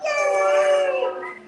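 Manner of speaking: high, loud happy cheer; big excited yay. Real, not acted. Cheering the kids.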